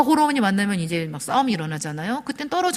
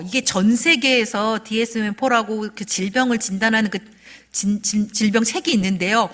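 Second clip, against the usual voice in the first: ordinary delivery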